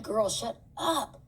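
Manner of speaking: put-on slow, exasperated girl shut up; annoyed at sister. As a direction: drag it out, fed up with her. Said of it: "And I'm like".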